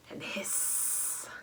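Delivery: a long whispered hiss; snake hiss. Acted. Teacher hissing like the snake for the children.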